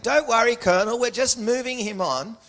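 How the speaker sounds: high-pitched impression of a placating daughter-in-law; daughter-in-law's reassurance. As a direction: hurriedly placate the colonel in a high mock voice. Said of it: "And the daughter-in-law saying".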